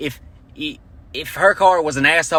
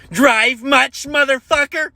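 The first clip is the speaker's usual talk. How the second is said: high, shrill-pitched mock woman yelling; mocking the angry lady; 11 semitones higher, slower than usual. Comic imitating the lady yelling in his face, high mock screech. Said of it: "she gets in my face and's like".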